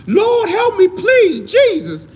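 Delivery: high, loud, panicked shriek for the Lord; hammed-up screaming plea while fleeing a knife; shrieking, terrified mock prayer; mock desperate scream. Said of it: "You will be running and screaming".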